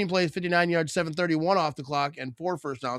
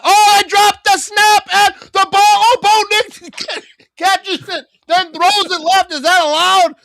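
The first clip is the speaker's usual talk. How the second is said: very high, loud, frantic commentary; astonished play call; 14 semitones higher, 10 dB louder than usual. Real, not acted. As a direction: yell the broken play in real disbelief. Shocked live call of broken play.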